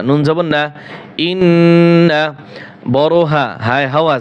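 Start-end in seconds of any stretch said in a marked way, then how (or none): 1.3-2.2 "ইন্না" with a long nasal hum on n; obligatory ghunnah. Shown as a demonstration. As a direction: hold the doubled n of ইন্না in the nose. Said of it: "ওয়াজিব গুন্না".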